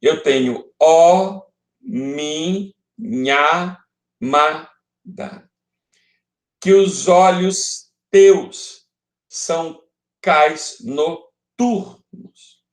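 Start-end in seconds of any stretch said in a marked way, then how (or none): 0.8-5.5 "ó minha amada" chanted out one slow syllable at a time; contando as sílabas. Shown as a demonstration. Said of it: "A contagem, ela é feita até aqui". 6.6-12.6 "Que os olhos teus são cais noturnos" in slow separate beats; contando as sílabas. Shown as a demonstration. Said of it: "Eu contarei até a última sílaba".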